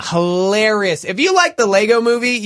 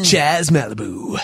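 slow, low radio-DJ name drop; deep radio voice; 4 semitones lower, slower than usual. Retold or imitated. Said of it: "have a deep voice like that".

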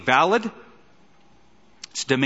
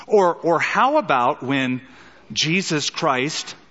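usual speaking voice

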